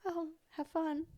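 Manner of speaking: high, soft, breezy mom voice; mock-cheerful high little goodbye; carefree mom imitation. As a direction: toss it off like a carefree parent dropping off. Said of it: "she drops you off and is just like".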